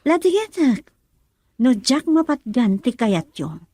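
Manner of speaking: loud passionate declaration; defiant outburst. Acted. Daughter loudly insists to her mother that she loves Demar. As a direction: pour out her heart loudly.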